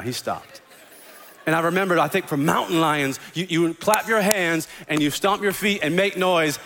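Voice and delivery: normal talking voice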